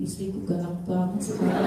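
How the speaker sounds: a hint of a grumbling husband; imitating husband. Husband complaining his wife got fierce, lightly mocked. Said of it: "Suamiku mungkin bilang gini".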